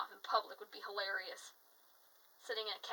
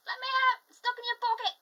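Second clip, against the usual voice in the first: high, loud tiny leprechaun voice; 14 semitones higher, 11 dB louder, faster than usual. Retold or imitated. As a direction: squeak like a trapped leprechaun. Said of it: "little leprechaun voice saying".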